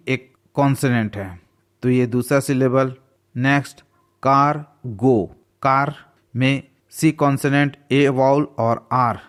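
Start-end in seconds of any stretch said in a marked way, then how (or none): 4.2-5.2 cargo slowly, as कार, pause, गो; split into syllables. Shown as a demonstration. Teacher showing learners two syllables, cargo slowly split in two. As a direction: break cargo into two drawn-out beats. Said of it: "टू syllable और three syllable".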